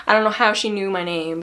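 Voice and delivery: usual speaking voice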